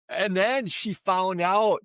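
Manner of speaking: usual speaking voice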